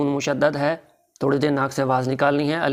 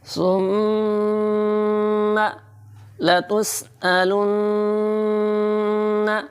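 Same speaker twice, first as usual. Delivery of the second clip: higher melodic chant with nasal hum; तजवीद से तिलावत; 7 semitones higher, much slower than usual. Shown as a demonstration. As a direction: recite with ग़ुन्ना on मीम and नून. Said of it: "नाक से आवाज़ निकालनी है". (ग़ुन्ना के साथ तिलावत)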